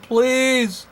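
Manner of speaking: high pained yelp; pained yell. Acted. Stuck narrator yelling at his rescuers, pained yelp. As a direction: cry out as the spine is jerked. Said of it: "I yelled".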